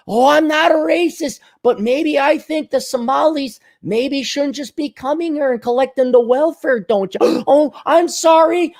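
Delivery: high, singsong, apologetic Minnesota caricature; hammed-up polite Minnesotan fretting; mock Minnesotan accent. Timid Republican apologizing for himself, high mock Minnesota accent.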